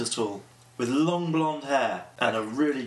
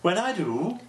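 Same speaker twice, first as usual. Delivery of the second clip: higher, sing-song attempt at Welsh; attempted Welsh accent; 5 semitones higher, slower than usual. Shown as a demonstration. Guest shows the interviewer a poor Welsh accent, attempted Welsh lilt. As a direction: put on a hammed-up Welsh lilt. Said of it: "That was my attempt at Welsh".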